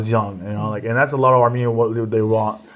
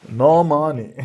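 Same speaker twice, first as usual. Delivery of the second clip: mock Armenian accent, laughing through it; 3 semitones higher, slower than usual. Comedian mocking the government email, in an Armenian accent.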